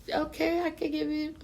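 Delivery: high, light imitation of a woman; a quick high voice for the lady; mimicking woman. Woman agreeing to give a cigarette, high mock voice.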